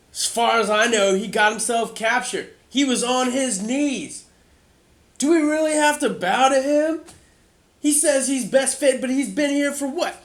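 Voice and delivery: high, loud, defiant protest; doubting townsman challenges the new king, loud; angry townsman. Acted. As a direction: shout him down in disbelief. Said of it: "and he goes".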